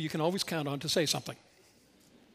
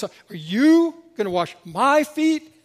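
"you" and "my" drawn out loud and high; slow question hammering "you" and "my"; stress on YOU and MY; 8 semitones higher, 10 dB louder, slower than usual. Shown as a demonstration. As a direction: punch YOU, then MY, in raised disbelief. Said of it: "All of the emphasis falls".

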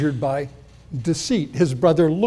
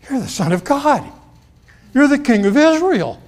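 rising to a high, loud cry of awe; awed exclaim; 8 semitones higher, 4 dB louder than usual. Retold or imitated. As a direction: burst out in amazed recognition. Nathanael's instant confession.

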